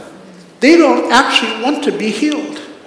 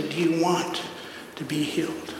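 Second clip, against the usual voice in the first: hushed question; 5 semitones lower, 16 dB quieter than usual. Real, not acted. Closing challenge, dropping to hush.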